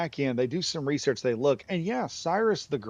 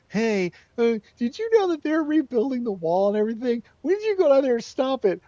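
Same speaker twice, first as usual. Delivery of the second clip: high, loud tattletale voice; whining enemies; 12 semitones higher, 7 dB louder than usual. Retold or imitated. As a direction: run to the king squeaking your complaint. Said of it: "and they're like".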